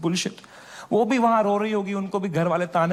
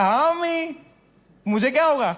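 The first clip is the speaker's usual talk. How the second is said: fake brave reassurance; 7 semitones higher, faster than usual. Pretending to be fine to mother.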